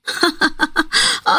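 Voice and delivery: high, scornful laugh; staged derisive giggle; mocking laugh. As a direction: laugh back at him in a high pitch. Nahr laughs back at Iyad.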